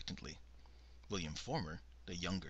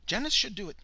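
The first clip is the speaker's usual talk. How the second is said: much higher and louder, eager; brightly; 14 semitones higher, 10 dB louder, faster than usual. A narrator's reading. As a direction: chirp it out eagerly. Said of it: "Bernice volunteered brightly".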